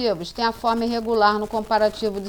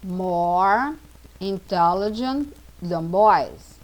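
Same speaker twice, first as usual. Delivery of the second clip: put-on slow, word by word, building up "More intelligent than boys"; slow teaching model; much slower than usual. Shown as a demonstration. Teacher slowly models the comparative to students, slow and careful. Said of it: "girls intelligent, uma palavra grande".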